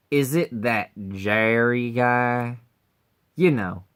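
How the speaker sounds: ordinary talk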